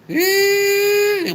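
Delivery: high stretched-out party yell; whooping party cry; hype yell. Real, not acted. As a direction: let out a big drawn-out "yeah". Streamer's hype catchphrase yell.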